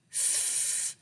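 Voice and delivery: "F-" as a long voiceless fff hiss; just blow the "F-" sound out, no vowel; isolated f sound. Shown as a demonstration. Teacher showing kindergarteners the sound f makes, a long voiceless hiss. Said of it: "they begin with a f sound".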